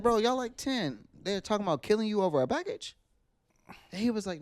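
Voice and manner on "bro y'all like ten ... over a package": much higher, stunned disbelief; incredulous. Retold or imitated. Telling the thief kid his friends are crazy, high incredulous disbelief. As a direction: act floored that they'd kill over a package. Confronting kid who stole packages.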